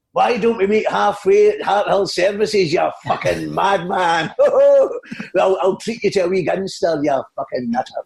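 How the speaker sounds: slow, high cackling comic; Billy Connolly. Acted. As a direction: drawl the teasing insults as the comedian. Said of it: "It's, it's Billy Connolly here".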